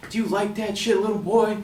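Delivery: angry stranger's taunt. Retold or imitated. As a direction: imitate a hostile man taunting you. Acting the angry man confronting him.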